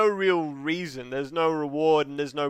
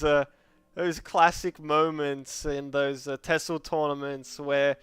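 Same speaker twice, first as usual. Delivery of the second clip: ordinary talk, nothing marked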